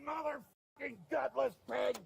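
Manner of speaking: furious yell at the cops; raging at the officers; angry shout. Real, not acted. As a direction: spit it out as a shouted insult. Arrested man yelling at police.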